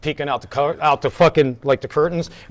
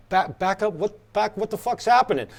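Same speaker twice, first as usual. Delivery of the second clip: higher, softer stammered questions; mock baffled reply, stumbling on the word; retold bafflement; 3 semitones higher, 4 dB quieter than usual. Band member answering the truck driver, retold bafflement.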